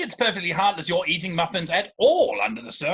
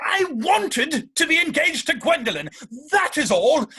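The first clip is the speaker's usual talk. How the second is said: heated protest; 9 semitones higher, 4 dB louder than usual. Acted. Jack pleads his engagement.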